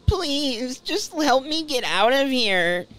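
begging, then turning cruel; plead, then go cold. Acted. Voices game dialogue.

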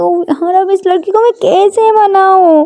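high, plaintive self-questioning; exasperated lament. Acted. Ishani wonders how to win her over, exasperated. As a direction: wail mildly to yourself in exasperation. Despairing alone after hang-up.

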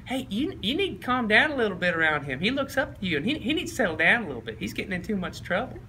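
high-pitched coach impression; Spurrier. Coach telling player to settle his friend down, high imitation. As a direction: counsel him in a high coach voice. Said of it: "He's like".

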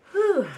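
high, loud relieved whew. Real, not acted. Exhale at hard challenge.

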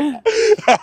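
big high burst of laughter; loud laugh. Real, not acted. Laughing at calling herself a crazy hospital patient, loud and high. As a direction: cackle at your own joke.

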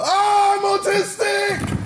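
high, loud, slow excited cry; excited shout. Real, not acted. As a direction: blurt it out with glee. Excited shouted self-introduction.

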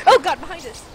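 loud, high startled cry; alarmed shout. Real, not acted. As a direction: yell out in sudden alarm. Sudden threat behind.

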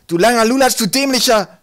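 high, very loud jeering yell; screaming insults. A narrator's reading. Trolls attacking Twig, screaming. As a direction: hurl abuse at the top of the voice. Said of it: "schrien sie und droschen auf ihn ein".

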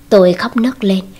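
plain reading voice

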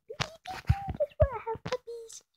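put-on high, sweet baby-talk teasing. Player taunts a pig in high baby talk. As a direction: coo at the pig like a toddler.

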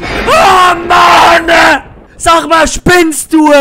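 high, loud scream of outrage; furious shout. Real, not acted. Player startled again, screaming at the game. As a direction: yell at the game in fright. Screams after another scare.

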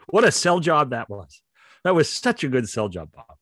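normal talking voice

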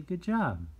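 bright, high "Good job" with a falling finish; cheerful praise. Shown as a demonstration. Teacher showing kids how to praise a friend, bright and high. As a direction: praise the drawing warmly and brightly. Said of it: "And so you wanna say".

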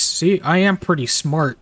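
ordinary delivery

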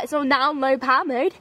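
ordinary talk, nothing marked

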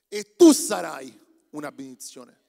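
"tu" shouted out slowly; preaching shout. Acted. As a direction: preach "tu sarai" at full force. Proclaims the blessing to Abraham.

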